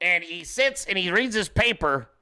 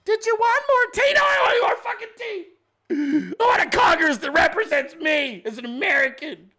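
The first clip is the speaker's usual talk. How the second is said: screaming at the TV; 12 semitones higher than usual. Retold or imitated. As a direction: scream like a man losing it at the TV. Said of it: "Just fucking screaming at the T V".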